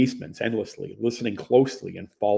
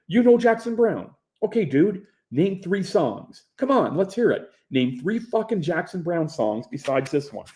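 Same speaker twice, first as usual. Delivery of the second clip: mocking challenge; 8 semitones higher than usual.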